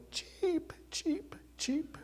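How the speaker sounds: very high, chirping cheep that fades into a laughing whisper; bird chirp. Retold or imitated. Pastor teasing Jeff as cheap with a high chirping bird voice. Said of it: "I go".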